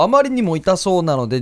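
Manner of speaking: ordinary talk, nothing marked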